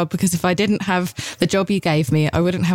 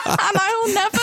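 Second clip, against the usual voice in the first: laughing; 12 semitones higher than usual. Real, not acted. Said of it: "It's all coming back to me now".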